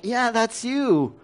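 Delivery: lightly acted, drawn-out "told you so" tone; knowing tease. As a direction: draw it out as a knowing jab. God voiced teasing the parent.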